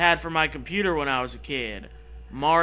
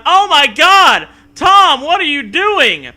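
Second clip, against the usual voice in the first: high, loud, slow horrified shriek; shocked shriek; 10 semitones higher, 12 dB louder, slower than usual. Acted. Wife finding son in the study, shrieking. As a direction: recoil in horror and shriek.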